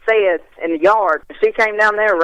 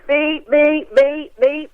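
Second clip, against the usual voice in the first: string of higher-pitched beeps standing in for words; imitated beeps; 7 semitones higher, much slower than usual. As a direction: beep in place of every garbled word. Mimicking fast Scottish talk she can't follow.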